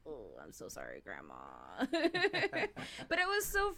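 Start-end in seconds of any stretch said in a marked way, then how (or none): 0.1-1.3 much lower, softer mock apology; a kid mortified after asking; understated sorry child; sheepish apology. 1.4-3.0 real giggle; high laugh. Real, not acted. Laugh after story.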